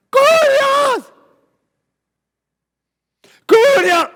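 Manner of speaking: piercing high scream; desperate shouting. Acted. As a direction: cry out at full voice, pleading. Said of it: "la cananea está gritando".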